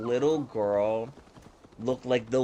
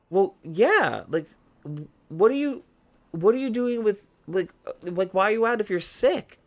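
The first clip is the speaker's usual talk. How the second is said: lightly voiced higher-pitched child; retold puzzled kid; 7 semitones higher than usual. Kid asks teacher why he is out sick, imitated puzzled child.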